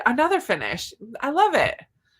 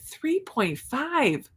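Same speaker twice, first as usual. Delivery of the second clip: incredulous; 7 semitones higher, much slower than usual. Real, not acted. Reacting to a viewer's 3.5 speed.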